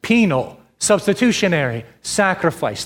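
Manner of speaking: "Penal", "substitutionary", "sacrifice" each set apart by pauses; a separate falling stress on "Penal" and on "sacrifice"; three words spaced out. Shown as a demonstration. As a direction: lay out the three words one at a time for the congregation. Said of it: "Look for the three words".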